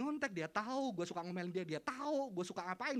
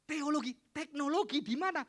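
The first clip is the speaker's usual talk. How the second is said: mock disbelief; 8 semitones higher, 9 dB louder than usual. Classmate in disbelief.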